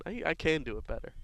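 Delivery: ordinary talk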